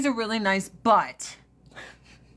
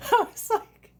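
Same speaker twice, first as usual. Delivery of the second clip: squeaky high laugh-cry; real helpless laughter; cracking up. Real, not acted. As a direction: break up laughing through the words. Laughing hard at South Park.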